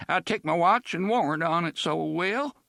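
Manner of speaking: put-on high, loud fearful old man; a scared old man swearing it.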